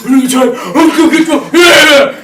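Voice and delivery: prayer building into a loud cheer; high, loud mock cheering; uncle cheering. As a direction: imitate a sports fan's rising whoop. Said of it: "My uncle goes".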